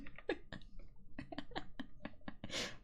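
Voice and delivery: a long, high, breathless laugh; high wheezing laugh. Real, not acted. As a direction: cackle high and airy. Laugh after mock outrage.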